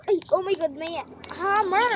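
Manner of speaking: high, loud alarmed cry; startled shout. Real, not acted. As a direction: yelp as the hammer appears. Hammer appears suddenly.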